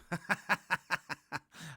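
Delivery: high burst of giggling; quick, repeated high chuckles; amused laugh. Real, not acted. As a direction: crack up at your own joke. Laughs at own garbled joke.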